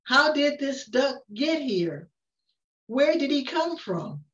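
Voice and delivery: plain reading voice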